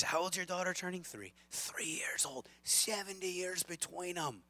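hammed-up Irish accent, rising high. Pub musician marvelling at the age gap, Irish accent. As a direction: play an amazed Irish pub regular. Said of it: "It's my best Irish accent".

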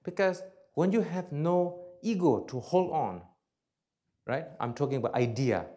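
ordinary delivery

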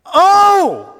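loud, high crying out Oh!. Shown as a demonstration. Preacher showing a desperate cry to God, loud and high. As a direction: wail Oh! up to God. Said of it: "I'm crying out to God here".

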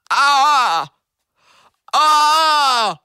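high, loud pained yelps. Pretend. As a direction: yelp like hot sauce burns. Caller yelping in mock pain.

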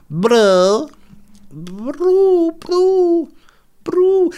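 very high, loud cooing bro; pigeon voice. Retold or imitated. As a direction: coo like a pigeon. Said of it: "Pigeons kinda go".

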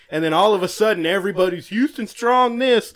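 really going off about Houston; angry rant. Real, not acted. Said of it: "Listen how mad he is".

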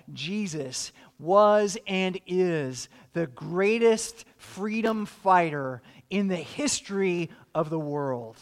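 ordinary talk

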